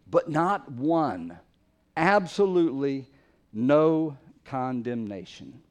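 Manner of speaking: "not one" stretched out at half speed; slow, weighty stress on "not one" and "no"; slow emphasis. Shown as a demonstration. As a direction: drive home "Absolutely no condemnation" word by word. Preacher hammering home the emphatic word.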